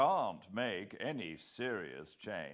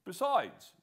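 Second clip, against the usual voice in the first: higher, louder second man cutting in; Whitaker's voice; 12 semitones higher, 7 dB louder than usual. A narrator's reading. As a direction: voice Whitaker, pressing the point. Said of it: "said Whitaker".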